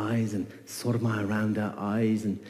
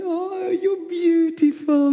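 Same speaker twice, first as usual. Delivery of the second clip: high, slow, doting coo; 6 dB louder, much slower than usual. Acted. Mother cooing to her frog baby, high and slow. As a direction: coo over a newborn.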